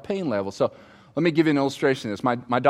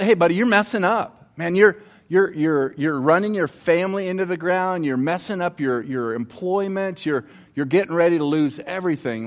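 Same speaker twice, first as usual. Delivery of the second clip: blunt friend confronting; 4 semitones higher than usual. Retold or imitated. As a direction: confront him like a worried buddy. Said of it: "accountability partners for you and say".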